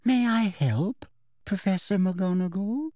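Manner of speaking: ooze false sweetness; poisonously sweet. A narrator's reading. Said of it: "in her most poisonously sweet voice".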